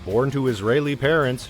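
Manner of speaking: plain reading voice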